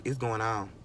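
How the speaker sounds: ordinary talk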